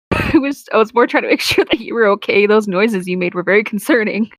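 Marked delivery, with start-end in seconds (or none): none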